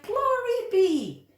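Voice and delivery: a flustered woman at her wits' end; exasperated cry of an old lady. Acted. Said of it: "The little old lady cried".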